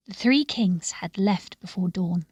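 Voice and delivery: ordinary delivery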